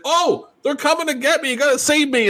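high whiny mock panic; high mock-frightened Eddie. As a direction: squeal it like a scared kid, mocking. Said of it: "Eddie's like".

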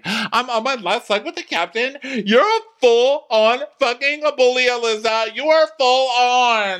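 high, loud screamed accusation; hammed-up screaming match; acted screaming row. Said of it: "Two crew members screaming at each other".

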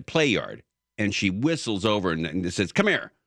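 usual speaking voice

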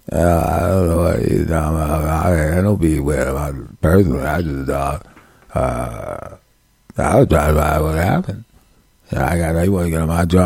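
low, mumbling slurred rapper voice. Retold or imitated.

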